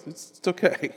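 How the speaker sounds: soft, comforting okay; softly reassuring the scared disciples. Acted. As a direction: soothe frightened friends. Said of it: "turn to the disciples and go".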